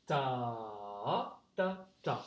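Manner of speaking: long low "Ta" swelling up on the second beat, then short "ta, ta"; second-beat stress. Shown as a demonstration. Teacher repeats rhythm for pupils, second-beat stress. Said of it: "On insiste bien sur le deuxième temps".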